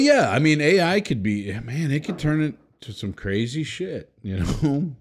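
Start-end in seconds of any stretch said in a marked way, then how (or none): none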